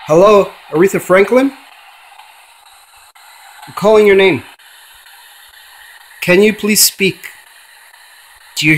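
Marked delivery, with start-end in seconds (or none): 0.1-7.3 summoning a dead singer through a spirit box; calling out to a spirit. Acted. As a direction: call into the void, hoping she answers.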